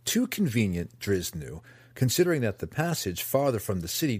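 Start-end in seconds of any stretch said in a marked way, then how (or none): none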